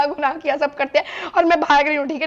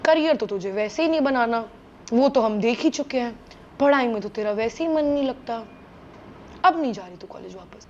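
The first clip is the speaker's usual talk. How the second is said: lower, softer, flat verdict of a father; stern dad; 5 semitones lower, 4 dB quieter than usual. Retold or imitated. Dad tells crying daughter she won't return, calm and stern. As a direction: lay down the law, unmoved. Dad's stern reply voiced.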